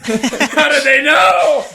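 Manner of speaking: high, loud, drawn-out wail; mock outraged yell. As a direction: blow up in hammed-up dismay. Mock outburst at being found out.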